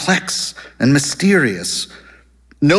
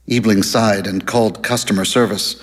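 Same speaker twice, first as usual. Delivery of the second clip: ordinary delivery, nothing marked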